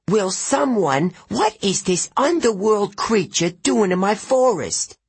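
put-on high, fussy old satyr voice, outraged; a pompous old goat-man scolding; cranky old satyr. A narrator's reading.

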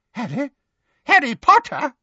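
high, incredulous questioning; flustered double take; startled. A narrator's reading. Startled Fudge notices Harry.

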